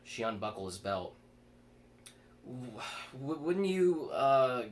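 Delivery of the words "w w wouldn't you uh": stammering, pitch jumping up and louder; nervous stutter. A narrator's reading. Chris flustered by the woman, stammering nervously. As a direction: trip over the first words, flustered. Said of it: "Chris stuttered".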